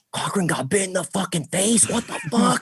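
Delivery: high, incredulous reaction; mimicked shocked coworkers. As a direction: imitate workmates hearing wild news.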